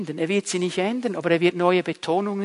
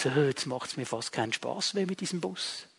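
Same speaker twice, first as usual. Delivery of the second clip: lower, much softer, deflated thought; disenchanted bus driver; 5 semitones lower, 11 dB quieter than usual. Acted. Bus driver realises the job has lost its fun, quietly deflated. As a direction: think it to yourself, quietly losing heart. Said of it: "wo du denkst".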